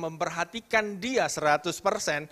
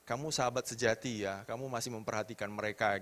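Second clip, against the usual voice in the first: lower, softer reassuring mother; calm mother; 6 semitones lower, 6 dB quieter than usual. Retold or imitated. Mother calmly comforting her hurt child, softer and lower. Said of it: "dengan tenang".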